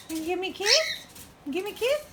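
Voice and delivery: high rising coo. Real, not acted. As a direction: coax a kiss from a pet. Asking bird for kiss.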